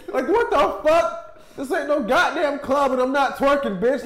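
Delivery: high, loud slow indignant rant; mock outrage. At the McDonald's drive-thru, scolding the worker, indignant.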